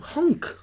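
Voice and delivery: high goose honk. Pretend. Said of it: "a sound like a goose".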